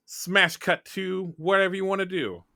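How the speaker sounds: normal talking voice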